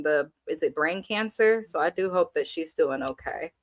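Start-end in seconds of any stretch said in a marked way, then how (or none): none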